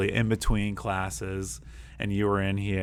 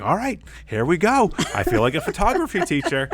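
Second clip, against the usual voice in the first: much higher, louder burst of excitement; excited teacher; 9 semitones higher, 6 dB louder than usual. Retold or imitated. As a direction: hype yourself up with a laugh. Teacher mock-excited quote to colleague.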